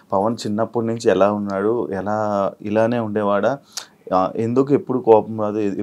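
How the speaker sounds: energetic anchor welcoming viewers; show host intro. Acted. Anchor greeting viewers at the show's start, upbeat host read.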